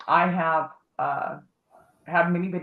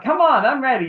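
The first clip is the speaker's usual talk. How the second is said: eager challenge; 7 semitones higher, 7 dB louder than usual. Retold or imitated. Amy quoted, eager for fight.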